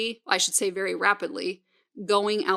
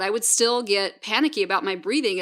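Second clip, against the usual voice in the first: normal talking voice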